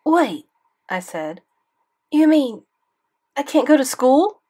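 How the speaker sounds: put-on boy learning he is suspended, alarmed. A narrator's reading. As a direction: panic at the news.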